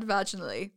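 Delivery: "vaginally" stressed on the first syllable; first pronunciation. Shown as a demonstration. Trying out how to say it, "vaginally" with front stress. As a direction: say "vaginally" as VAJ-in-ally.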